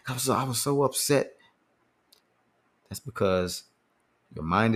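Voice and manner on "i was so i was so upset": mock upset person. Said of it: "I hear people all the time say".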